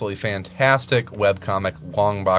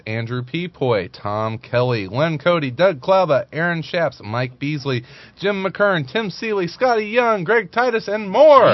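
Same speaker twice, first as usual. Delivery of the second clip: announcer roll call; 6 semitones higher than usual. Acted. Host hyping the convention guest list, building announcer read. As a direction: build the names up like a promo.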